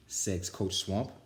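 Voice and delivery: usual speaking voice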